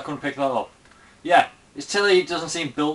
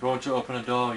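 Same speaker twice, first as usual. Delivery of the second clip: ordinary talk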